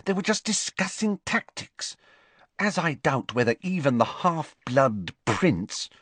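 lightly voiced clever schoolgirl; Hermione voice. A narrator's reading.

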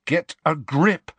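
stern, measured rebuke. Acted. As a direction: tell a friend off, word by word. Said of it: "said Harry sternly".

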